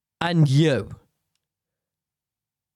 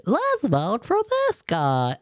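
higher, slow, dopey voter voice; mocking a clueless voter at the polls, higher and slow; 6 semitones higher, slower than usual. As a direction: lift it up like an excited fool. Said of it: "some people are around the voting office going".

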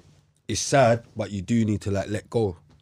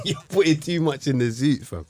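ordinary talk, nothing marked

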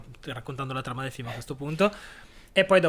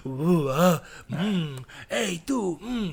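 catcalling men; 3 semitones higher, 3 dB louder, much slower than usual. Retold or imitated. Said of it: "ci sono gli uomini dietro che fanno".

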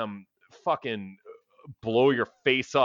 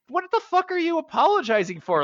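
much higher, almost yelping in disbelief; incredulous outburst. Real, not acted. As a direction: throw the question out, outraged and baffled. Exasperated rant about movie couple.

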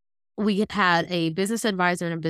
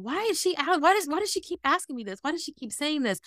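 puzzled inner voice; 8 semitones higher, 3 dB quieter than usual. Retold or imitated. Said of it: "my brain kept saying".

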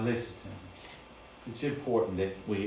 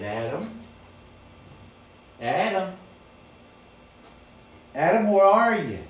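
rising calls, each louder and higher; God calling Adam through the garden, growing louder; 9 semitones higher, 12 dB louder than usual. Acted. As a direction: call out searching for a lost child.

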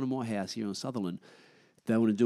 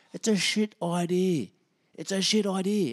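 exasperated rant; 10 semitones higher, 4 dB louder than usual. Real, not acted. Vlogger venting to camera about town closures, exasperated. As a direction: spit out how dumb it is. Ranting about early closing times.

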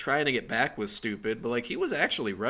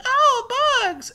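very high, loud imitation scream; hammed-up squeal of alarm; mock horrified shriek; 8 dB louder than usual. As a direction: shriek in comic disgust at bugs. Acts out girl finding bugs.

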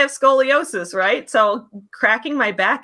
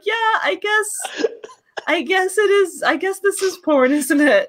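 laughing resignation; 10 semitones higher than usual. Retold or imitated. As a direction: give in, half crying laughing.

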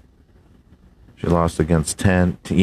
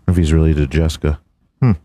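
hammed-up race announcer; 6 semitones higher than usual. Reading the NASCAR top finishers to his buddy, hyped announcer. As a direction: boom out the countdown like a race caller. Hams up the race results countdown.

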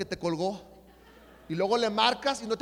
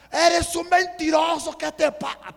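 loud, high angry accusation; imitated furious brother; 7 semitones higher, 9 dB louder than usual. Brother accuses him on the phone, acted furious yelling. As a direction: yell it as the enraged brother. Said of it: "pero súper enojado".

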